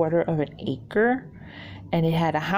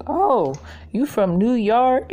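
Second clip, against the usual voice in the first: put-on slow, higher old Texan grandfather; grandfather drawling; 6 semitones higher, 4 dB louder, slower than usual. Grandfather greeting the New York visitor, slow drawl. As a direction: drawl it like a kindly old man. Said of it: "the grandfather was like".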